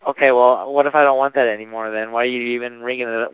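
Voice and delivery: ordinary delivery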